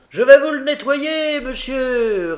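high, loud hawker's voice; pedlar's patter. A narrator's reading. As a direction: call out like a street seller. Pedlar's sales patter in story.

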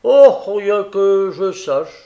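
loud, slow, high-starting reply; mail-coach courier character; courier chatting. A narrator's reading. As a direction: draw out a casual answer. Courier gives the news.

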